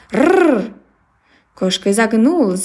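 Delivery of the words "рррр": rolled rrr dog growl. Acted. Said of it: "и зарычал".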